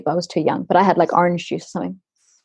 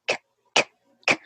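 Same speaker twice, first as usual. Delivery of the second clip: imitated choking; 3 dB quieter, much faster than usual. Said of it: "she's going".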